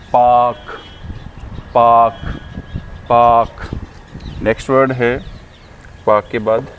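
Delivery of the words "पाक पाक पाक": पाक loud, "paak" with no r; loud, separate model takes of पाक, long "aa"; long aa sound. Shown as a demonstration. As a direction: stretch the vowel in पाक. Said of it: "इसको बोलते हैं".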